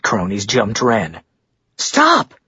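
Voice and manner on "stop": very high, alarmed cry; sudden high-pitched shout of fear; frightened shout. A narrator's reading. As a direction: cry out to halt the fight. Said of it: "Marguerite shouted, afraid they would hurt Wren".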